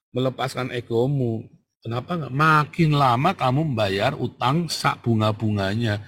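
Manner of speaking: ordinary talk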